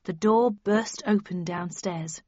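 ordinary delivery

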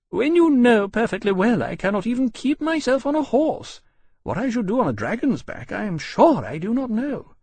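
high-pitched lady protesting loudly; indignant. A narrator's reading. Mother who cannot ride a horse protests, indignantly. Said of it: "she said indignantly".

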